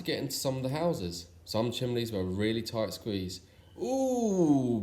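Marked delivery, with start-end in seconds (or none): 3.8-4.8 high, loud strained ooh; high moan. Acted. Father Christmas stuck in a tight chimney, a high strained moan. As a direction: squeeze out a pained ooh. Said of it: "moaned Father Christmas".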